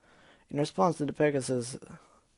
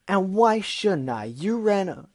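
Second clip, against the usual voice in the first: loud, high and slow; angry shout; 6 semitones higher, 6 dB louder, slower than usual. Acted. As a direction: shout it, hurt. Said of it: "Gilda shouted".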